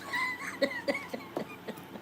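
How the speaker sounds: high-pitched playful giggle; a squeaky little laugh at her own joke. Real, not acted. Laughs after joking about diamonds.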